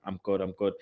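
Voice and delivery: ordinary delivery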